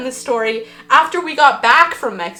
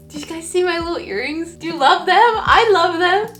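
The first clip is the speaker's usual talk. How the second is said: high, loud, squealing delight over cute earrings; gushing excitement; 7 semitones higher, 6 dB louder than usual. Real, not acted. Showing viewers her earrings, squealing with excitement. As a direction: gush like you just got the cutest gift.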